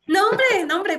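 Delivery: high, loud "No, hombre"; hearty brush-off. Real, not acted. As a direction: wave off the thanks warmly. Responde al agradecimiento. (rechazo efusivo del agradecimiento, agudo y fuerte)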